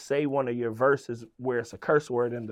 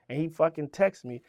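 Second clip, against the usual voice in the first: usual speaking voice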